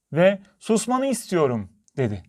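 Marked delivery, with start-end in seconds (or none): 0.6-1.7 high-pitched, mildly cross demand; annoyed little girl. A narrator's reading. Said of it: "Küçük kızın sinirleri bozuldu".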